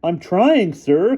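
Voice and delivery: put-on high loud boy's voice; cried. A narrator's reading. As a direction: cry out helplessly. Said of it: "cried Sidney Rampolsky".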